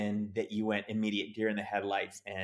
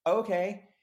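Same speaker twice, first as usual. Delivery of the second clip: high, louder mock-anxious agreement; anxious compliance; 10 semitones higher, 6 dB louder than usual. Actor told to start over mid-anxiety attack, anxious. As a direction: agree while panicking inside.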